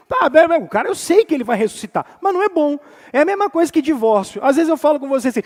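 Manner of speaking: usual speaking voice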